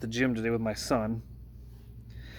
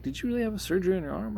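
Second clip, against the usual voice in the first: fast, much higher astonished question; surprised classmate; 10 semitones higher, much faster than usual. Retold or imitated. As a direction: rattle it off high in disbelief. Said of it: "she's like".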